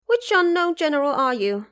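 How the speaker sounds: high, loud battle cry; Monkey King shouting a challenge across the field; yelling challenge. A narrator's reading. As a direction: yell it up at the stranger. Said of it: "he yelled from the top of his voice".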